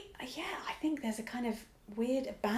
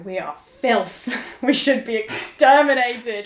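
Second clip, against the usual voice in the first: slow, louder hammed-up contempt; mock disgust; 2 semitones higher, 9 dB louder, slower than usual. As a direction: sneer at humanity, drawn out. Mock misanthropic rant.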